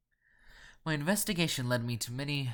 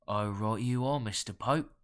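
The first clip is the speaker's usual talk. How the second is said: louder, throaty Cockney reply; guttural nasal Cockney; 4 dB louder than usual. A narrator's reading. Caller humouring Mister Pope, in guttural Cockney. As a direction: agree in thick rough Cockney. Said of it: "guttural yet nasal Cockney accent".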